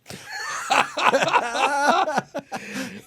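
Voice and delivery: loud, high burst of laughter; cracking up at the memory; big laugh. Real, not acted. Laughs retelling the moment.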